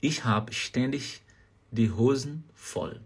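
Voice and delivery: slow, careful phrase, drawn-out end; slow German demo. Shown as a demonstration. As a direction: spell the sentence out slowly for learners. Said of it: "Você pode falar essa frase".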